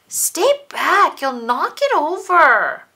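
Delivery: high, loud brother telling her off; bossy warning. Acted. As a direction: keep her away from the tower. Brother guarding his tower.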